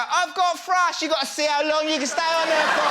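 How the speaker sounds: loud, high brassy boast; hammed-up brassy woman; brash woman bragging. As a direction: brag to Linda like a lad.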